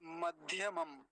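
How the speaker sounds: very slow Sanskrit model with long vowels; word-by-word recitation. Shown as a demonstration. Teacher models the mantra for students, slow word-by-word recitation. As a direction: recite each word measured, pausing for repetition.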